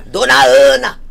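loud call across a room. Retold or imitated. Repeats the call.

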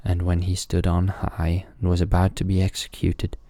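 ordinary delivery

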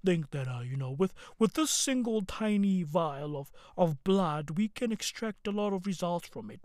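mock her put-on low tone; a hint of a forced deep CEO voice; Elizabeth Holmes voice. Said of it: "she put on that voice".

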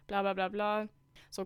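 ordinary delivery, nothing marked

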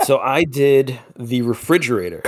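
usual speaking voice

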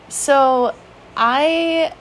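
ordinary delivery

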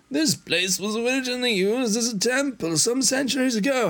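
high put-on character voice; high, lilting game character; Arya voice. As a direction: pitch the whole line well up. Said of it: "How's that voice?".

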